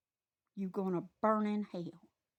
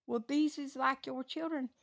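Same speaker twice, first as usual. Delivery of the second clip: normal talking voice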